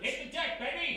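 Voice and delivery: high, loud warning with a laugh; man warns his partner to get down; urgent shout. Acted. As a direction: yell it as shots start. Warns partner during shooting.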